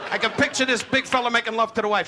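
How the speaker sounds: ordinary talk, nothing marked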